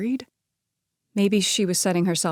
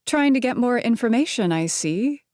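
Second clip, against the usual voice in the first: playful, teasing jab; teasing quip; 6 semitones higher than usual. A narrator's reading. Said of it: "she quipped".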